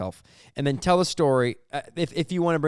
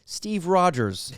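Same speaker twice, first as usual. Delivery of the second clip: slow deliberate; 3 semitones higher, much slower than usual. Retold or imitated. Slow deadpan correction.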